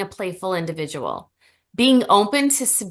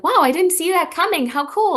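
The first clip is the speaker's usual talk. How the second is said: higher, louder acted-out wow; pleasantly surprised; 10 semitones higher, 5 dB louder than usual. Coach modeling an open reaction to a surprise, pleasantly surprised. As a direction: act delighted by an unexpected treat, pitched up.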